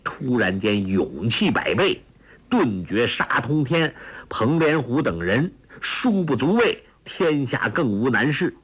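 plain reading voice

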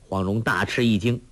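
ordinary delivery

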